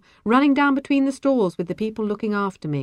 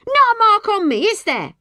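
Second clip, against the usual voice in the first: high, loud indignant challenge; shrieked challenge, easing on the tag; demanding; 8 dB louder than usual. A narrator's reading. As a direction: demand it at a shout. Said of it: "she demanded".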